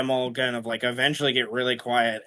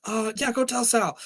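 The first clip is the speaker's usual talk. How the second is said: put-on nervous gang kid; 11 semitones higher than usual. Another boy answers.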